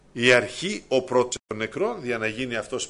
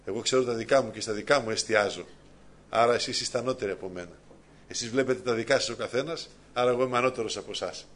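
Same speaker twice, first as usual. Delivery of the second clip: ordinary delivery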